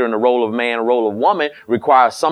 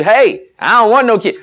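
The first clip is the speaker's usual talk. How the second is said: higher, louder blunt mock husband; mock husband refusal; 9 semitones higher, 5 dB louder than usual. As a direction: bark it like the husband who says no.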